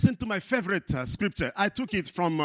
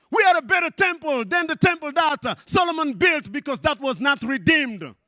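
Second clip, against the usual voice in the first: high, loud fervent preaching; preaching at full force; 10 semitones higher, 6 dB louder than usual. Acted. Preacher proclaiming believers are God's temple, shouting. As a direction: lift the congregation up.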